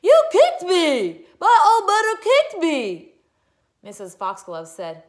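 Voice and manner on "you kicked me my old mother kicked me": high, very loud outraged wail; hammed-up crybaby scream. A narrator's reading. Girl pushed by her mother's foot, screaming. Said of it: "Melody screamed".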